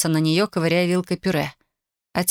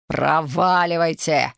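slow, drawn-out order to leave; father's yell; slower than usual. A narrator's reading. Father orders intruder out, drawn-out mild yell. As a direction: stretch it into a restrained angry yell. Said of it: "завопил отец".

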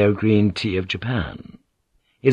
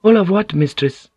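high, loud eager question; breathless; 10 semitones higher, 5 dB louder than usual. A narrator's reading. Maid joins the gossip, breathlessly eager. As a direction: ask all agog, high and breathless. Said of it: "one of the maids said breathlessly".

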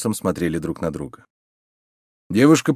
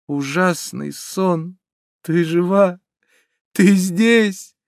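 high, fervent outpouring with pauses; impassioned, overwhelmed lover; overcome with joy; 7 semitones higher than usual. A narrator's reading. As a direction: build from halting confession to high cries. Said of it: "Индус рыдал от радости".

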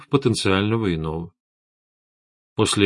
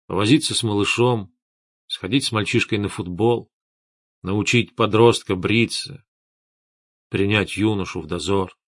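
softened, weary confession with pauses between wishes; subdued, wistful; 2 semitones lower, 3 dB quieter than usual. A narrator's reading. Old chief confessing to his subordinate he longed for his son, subdued. Старый интриган сник, говорит о сыне.

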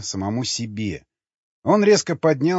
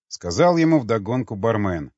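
ordinary delivery

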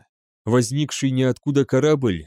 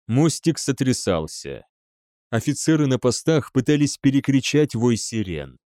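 ordinary delivery, nothing marked

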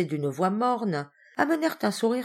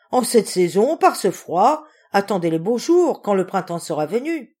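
loud, higher-pitched protest; emphatic objection; 3 semitones higher, 8 dB louder than usual. A narrator's reading. Mistress urging the servant to wait for spring, emphatically. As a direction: object forcefully, voice raised.

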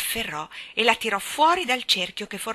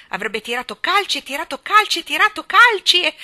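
loud, high, mounting repetition; frantic narration; 8 semitones higher, 10 dB louder than usual. A narrator's reading. Narrating Jane kicking to get free, frantic. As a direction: build the struggle, voice raised.

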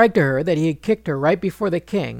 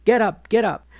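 sharp, raised, higher scolding wake-up; annoyed scolding; 6 semitones higher, 4 dB louder than usual. A narrator's reading. Wife scolding her sleeping husband to wake him, sharp and raised. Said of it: "Draupadi soon got annoyed and scolded him".

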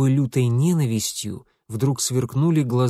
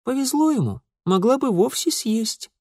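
mocking little girl; 11 semitones higher than usual. Sister mocking.

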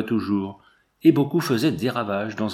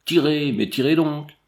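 raised, higher shout of command; shouting with all his might; 5 semitones higher than usual. A narrator's reading. Captain yelling at his men under fire. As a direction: yell the order to fire. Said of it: "hurlant de toutes ses forces".

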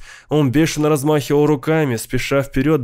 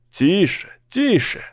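usual speaking voice

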